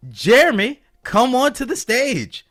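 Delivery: high, loud announcer call; hyped host calling up a guest; stage introduction. Acted. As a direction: bring the guest on with a drawn-out shout.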